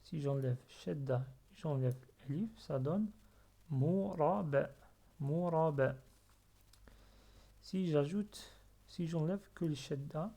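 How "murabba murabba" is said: "murabba" lentement, avec un b simple non redoublé; accent simple. Shown as a demonstration. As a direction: dire "murabba" sans appuyer ni retenir le b. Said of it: "avec accent simple". (« murabba » posé, syllabe par syllabe, b simple)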